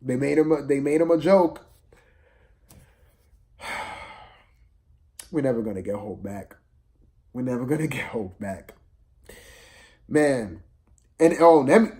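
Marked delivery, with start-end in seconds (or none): none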